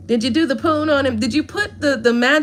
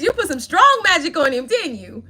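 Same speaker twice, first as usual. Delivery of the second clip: normal talking voice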